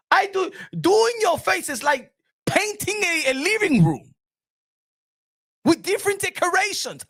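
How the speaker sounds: indignant makeup artist yelling; irate makeup artist. Retold or imitated. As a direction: rant as the offended makeup artist. High rant continues.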